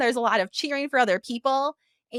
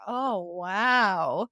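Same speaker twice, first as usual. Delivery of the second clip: low, drawn-out oh wow; slow, leering mock wow; mimicked admiring wow; 4 semitones lower than usual. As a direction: imitate the ogling cooks. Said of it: "or hear".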